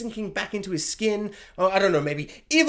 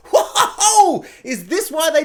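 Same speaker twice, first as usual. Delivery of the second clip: loud, high, drawn-out whoa; exaggerated shocked exclamation; amazed whoa; 7 semitones higher, 6 dB louder, much slower than usual. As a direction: let out a big stunned whoa, hammed up. Reenacts her shocked reaction.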